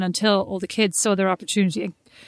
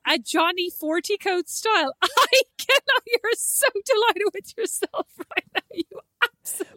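high, squealing through real laughter; can barely speak for laughing; helpless laughing protest; 11 semitones higher than usual. Real, not acted. Friend teased about her coat style, laughing protest.

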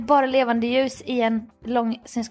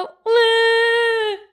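long, high mock bawl; hammed-up sustained sobbing wail; crying wail; 11 semitones higher, 4 dB louder than usual. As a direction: imitate loud, helpless crying. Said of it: "Alltså verkligen grät".

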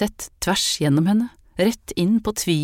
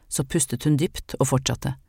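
ordinary delivery, nothing marked

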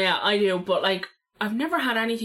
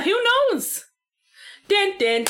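dramatic; 9 semitones higher than usual. Pretend. Dramatic ending joke.

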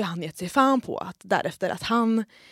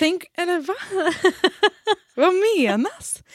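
indignant disbelief; 8 semitones higher than usual. Real, not acted. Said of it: "indignant disbelief".

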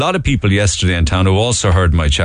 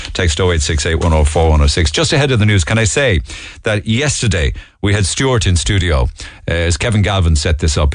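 normal talking voice

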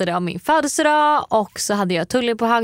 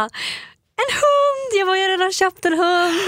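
much higher, squealing with excitement; excited squeal; 12 semitones higher, slower than usual. Retold or imitated. Teasing her boyfriend, high excited squeal. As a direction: shriek it out like a giddy prank. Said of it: "Och jag bara".

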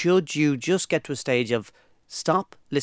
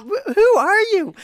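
much higher, slow, incredulous; baffled who-are-you; 5 dB louder, slower than usual. Retold or imitated. Reacting to the speaker's sudden accent, high incredulous. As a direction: act stunned at the fake voice.

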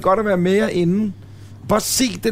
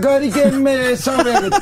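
high, drawn-out Bornholm lilt with a laugh; mock Bornholm accent; 7 semitones higher, slower than usual. Co-host showing how the sawmill man on Bornholm talks, mock accent. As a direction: stretch it out slowly in a put-on Bornholm accent. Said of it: "Man skal på optag sådan bag munden".

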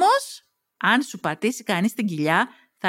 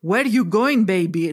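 put-on a light German-tinged posh English accent; lightly voiced German flirt in English; German-Oxford accent; 3 dB louder than usual. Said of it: "με οξφορδιανή προφορά downtown Düsseldorf".

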